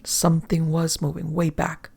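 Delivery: ordinary delivery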